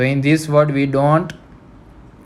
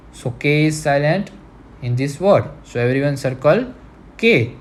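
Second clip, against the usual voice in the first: ordinary talk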